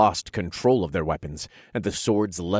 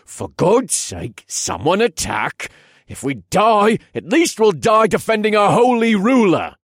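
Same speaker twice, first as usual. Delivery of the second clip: desperate shout; 10 dB louder than usual. Acted. Soldier rallies men in desperation.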